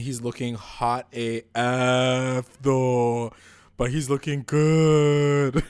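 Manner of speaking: loud, drawn-out swooning hype; slow, over-the-top fangirl gushing; gushing admiration. Real, not acted. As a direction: stretch it out and belt your approval. Gushing over Hiram's arrival.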